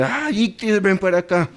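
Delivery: sharp, high, irritated summons to the puppy; annoyed call to dog. Retold or imitated. Preacher imitates losing patience calling his puppy, irritated high call. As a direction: bark at the pup, fed up. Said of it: "Y uno lo hace con ira, con enojo".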